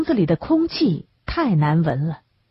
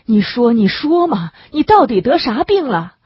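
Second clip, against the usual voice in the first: urgent pressing; 5 semitones higher, 4 dB louder than usual. A narrator's reading. Worried friend pressing the sick man.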